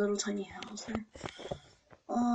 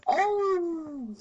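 startled cry; 7 semitones higher, 4 dB louder than usual. Real, not acted. Startled by the seeker.

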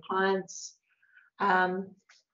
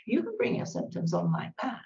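ordinary talk, nothing marked

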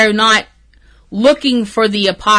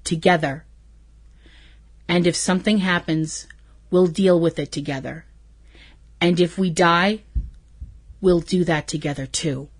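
quiet earnest quote; 4 semitones lower, 7 dB quieter than usual. Retold or imitated. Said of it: "Sam answers him".

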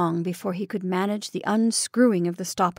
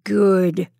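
snarled; 6 dB louder than usual. Acted. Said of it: "snarled George".

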